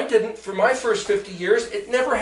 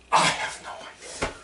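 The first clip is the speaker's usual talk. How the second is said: whispered aside; 7 dB quieter than usual. Acted. Mock answer to silly question.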